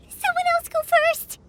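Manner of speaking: scared plea. Acted. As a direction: push the others ahead out of fear. Scared, pushing others ahead.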